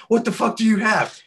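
loud, high-pitched panicked demand; losing it. Retold or imitated. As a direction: yell the question in a retold freak-out. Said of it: "I'm just losing my shit".

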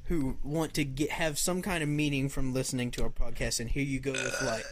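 usual speaking voice

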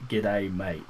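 Australian accent, low and easygoing; Australian greeting demo. Shown as a demonstration. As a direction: show how an Aussie says G'day. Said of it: "all you have to do is just say".

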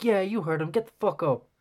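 rally the crowd like a hype man. Retold or imitated. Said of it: "I can be like".

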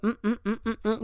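high, searching hums like a detective sniffing around; mock detective hmm-ing, pitched well up; sleuthing relatives. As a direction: hum high and nosy, hunting for a clue. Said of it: "They're like".

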